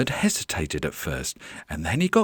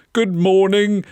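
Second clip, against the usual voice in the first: high, loud call over noise; raised storybook shout; shouted greeting; 11 semitones higher, 7 dB louder than usual. Acted. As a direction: shout hello over a squawking bird. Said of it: "shouted Mister Gonzalez to them".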